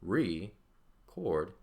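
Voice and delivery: "record" drawn out as two separate syllables, re, CORD; split into two syllables. Shown as a demonstration. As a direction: break "record" slowly into re and cord. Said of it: "There's our two syllables there".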